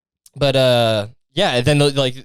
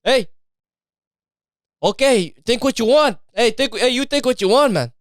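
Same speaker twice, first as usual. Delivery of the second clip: an Italian bit gone Mexican, higher in pitch; Mexican-sounding accent; 8 semitones higher than usual. Acted. Italian guy brushing off the doubter, slipped Mexican accent. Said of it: "I just turned Mexican somehow".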